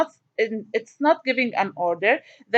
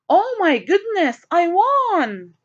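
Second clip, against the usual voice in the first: high, slow delighted exclamation; excited; 5 semitones higher, slower than usual. Shown as a demonstration. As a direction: read it like you just won, thrilled. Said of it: "I'm excited".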